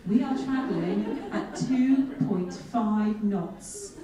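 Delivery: very slow, drawn out, laughing through it; demonstrating slowness. Shown as a demonstration. Telling the audience how slow the boat was, very slow. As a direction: stretch each word to show the crawl. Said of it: "I shall demonstrate that for you".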